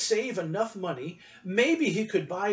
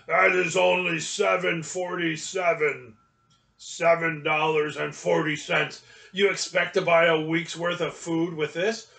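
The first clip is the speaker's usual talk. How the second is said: grumpy gravelly shopkeeper; 4 dB louder than usual. A narrator's reading. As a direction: growl it out like a mean old shopkeeper. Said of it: "in a grumpy, gravelly voice".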